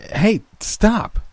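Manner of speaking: high-pitched alarmed yell; narrated yell of protest, high but not full volume. A narrator's reading. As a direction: cry out to stop your attackers, pitch jumping up. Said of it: "I yelled as they aggressively grabbed me".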